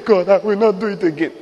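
high-pitched put-on plea to God; mock repentant prayer. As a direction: mimic a penitent making empty promises. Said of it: "It's not to say".

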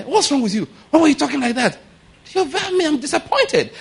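very high-pitched, hammed-up protest; comic high voice of a let-down job seeker; mock complaint. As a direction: squeal your hurt at the uncle in mock outrage. Acted complaint to the uncle.

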